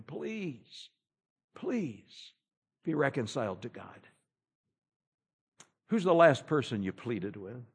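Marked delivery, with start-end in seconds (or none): 0.0-4.1 hushed, softer begging; soft pleading. Retold or imitated. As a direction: plead gently, almost whispering. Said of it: "Daniel is begging this man, pleading with him".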